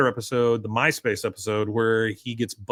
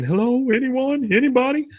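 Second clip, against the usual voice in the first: calling for help; 11 semitones higher than usual. Retold or imitated. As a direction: imitate someone stranded, calling out. You hear him saying.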